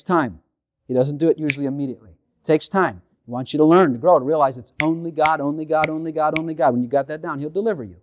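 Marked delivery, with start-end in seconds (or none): none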